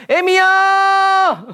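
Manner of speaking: very high, drawn-out mock wail; hammed-up spirit voice, loud and stretched; shaman impression. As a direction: wail like a shaman possessed by a dead mother. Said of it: "무당들 가면 그러잖아요".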